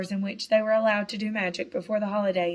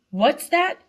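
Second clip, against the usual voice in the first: sharp, louder, higher spit of a question; spat; 7 semitones higher, 6 dB louder than usual. A narrator's reading. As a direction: spit the words at him in contempt. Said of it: "spat Mister Malfoy".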